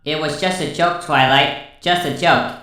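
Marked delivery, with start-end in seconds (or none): none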